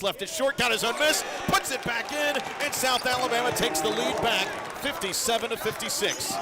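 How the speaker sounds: loud, high, thrilled play-by-play; excited call. Real, not acted. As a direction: raise your voice as the home team scores. Live call of lead-changing basket.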